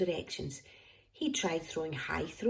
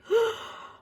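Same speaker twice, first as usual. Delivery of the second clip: gasp; 4 dB louder than usual. A narrator's reading. He threw his chocolate away.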